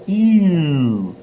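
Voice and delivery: high pinched "Ew" like seeing a booger; grossed-out ew, lips rounded; disgusted ew. Shown as a demonstration. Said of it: "the booger on the finger sound".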